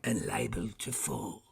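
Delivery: mild protest in character; remonstrating. A narrator's reading. Scrooge telling the spirit he may fall, mild protest. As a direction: object with a little weight. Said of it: "Scrooge remonstrated".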